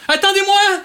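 high, urgent call to wait; calling after them. Acted. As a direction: call out high to people leaving. Calls after departing group. (appel aigu pour qu'on l'attende)

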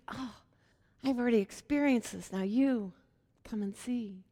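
soft, sinking to a whisper; hushed invitation. Retold or imitated. Philip gently invites Nathanael, fading to a whisper. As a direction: draw him in quietly, almost whispering.

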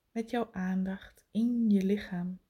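right in your ear. Acted. Intimate meditation cue, whispered.

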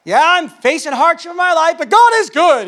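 fake-happy church voice over hidden hardship; mock pious cheer. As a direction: ham up the forced Christianese praise.

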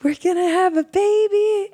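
put-on very high, giddy voice for the boyfriend; imitating excited fiancé. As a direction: play him bursting with joy. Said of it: "he's like".

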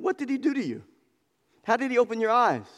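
Pharisees interrogating. Retold or imitated. Pharisees questioning the man.

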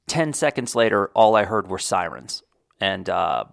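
normal talking voice

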